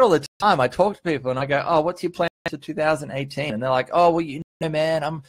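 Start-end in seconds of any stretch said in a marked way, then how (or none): none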